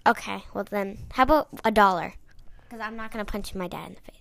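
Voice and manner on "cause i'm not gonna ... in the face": quick whispered aside; whispering. Real, not acted. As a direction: whisper it fast under your breath. Aside to the side.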